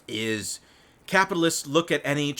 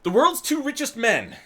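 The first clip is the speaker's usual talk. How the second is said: put-on Chicago accent, higher and louder; mock Chicago news anchor; 11 semitones higher, 4 dB louder than usual. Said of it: "I have no reason to do a Chicago accent".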